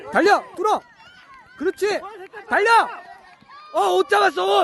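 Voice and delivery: excited sideline yelling. Real, not acted. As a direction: urge the runner on eagerly. Excited parent during an attack.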